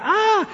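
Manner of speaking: high-pitched mock scream; grito fingido. (grito agudo fingido de juego)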